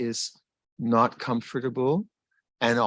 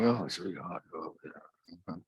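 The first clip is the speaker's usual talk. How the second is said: low, soft mock complaint; mild grumbling; 6 semitones lower, 10 dB quieter, faster than usual. Walker grumbling about the hot season, mildly mock complaining. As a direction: mutter a half-hearted grumble to yourself. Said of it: "I'm complaining".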